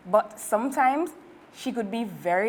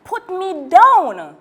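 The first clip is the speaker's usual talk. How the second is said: much louder, higher, slow yell; girl dangled over the monster's mouth; panicked yell; 8 semitones higher, 11 dB louder, slower than usual. Acted. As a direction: demand it in fright. Girl hanging upside down.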